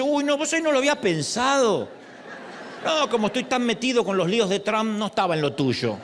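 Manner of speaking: joking voice of a distracted God; mock surprised God. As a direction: play God caught off guard, laughing. Mocking God's imagined answer.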